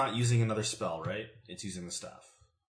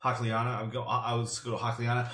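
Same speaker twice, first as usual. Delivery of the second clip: normal talking voice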